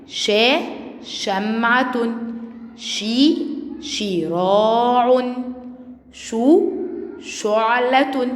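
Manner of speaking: each sound alone, then its word stretched: شا شمعة، شي شراع، شو شعلة; very slow syllables in شمعة, شراع and شعلة, endings fully voiced; slow model reading. Shown as a demonstration. Teacher rereads the words for first graders, slow drawn-out model reading of شا شمعة، شي شراع، شو شعلة. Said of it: "يلا نقرأ الكلمات مرة تانية".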